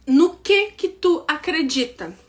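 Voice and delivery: ordinary delivery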